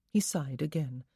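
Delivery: ordinary delivery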